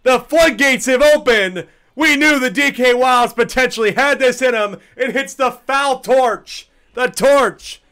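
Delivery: high, loud, slowed home run call. Acted. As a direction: sell the homer big.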